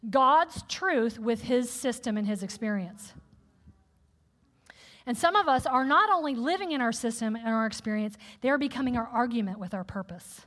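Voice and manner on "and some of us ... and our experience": ordinary talk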